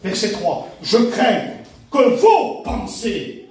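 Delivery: usual speaking voice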